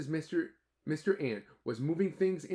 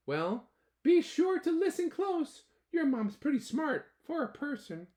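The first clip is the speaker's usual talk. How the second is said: put-on high, loud small ant kid, relieved; ant boy voice; 6 dB louder than usual. Ant boy telling his dad about the rescue, high loud voice.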